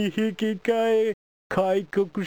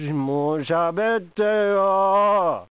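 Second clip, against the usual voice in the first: drawn-out plea; much slower than usual. Acted. Begging silent audience to speak.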